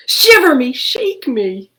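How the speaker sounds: high, loud alarmed exclamation; startled ghost cry. Acted. Little ghost Gilbert startled by the dragon's fire, high alarmed cry. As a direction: shriek it out in fright.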